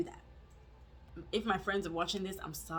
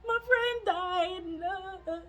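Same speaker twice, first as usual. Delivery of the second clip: high, drawn-out hammed-up wail; re-enacted bawling; 12 semitones higher, 4 dB louder, much slower than usual. Storyteller replaying telling Doug about her friend, mock bawling. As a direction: wail it like exaggerated sobbing. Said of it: "I'm bawling, tears".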